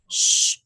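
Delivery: a high, sharp hiss to hush someone; sharp shushing hiss. A narrator's reading. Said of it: "hissed Professor McGonagall".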